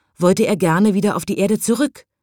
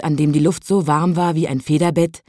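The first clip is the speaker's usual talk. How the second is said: ordinary delivery, nothing marked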